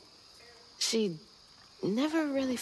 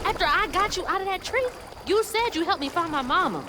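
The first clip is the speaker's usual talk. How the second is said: loud, high, accusing; indignant protest; 8 semitones higher, 5 dB louder than usual. Acted. Girl reminding the mudcat of his promise, loud and indignant. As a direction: call him out on his broken promise.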